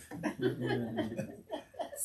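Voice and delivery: soft airy laugh; a quiet wheezy chuckle; breathless laugh. Real, not acted. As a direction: laugh almost without voice. Laughs at own story.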